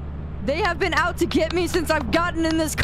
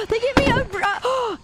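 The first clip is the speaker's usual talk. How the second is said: surprised gasp; 10 semitones higher than usual. Real, not acted. Gasps in surprise.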